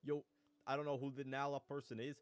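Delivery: loud high boastful dude; cocky bro voice. Acted. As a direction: hype yourself up for a fight. Lobster brags about fighting.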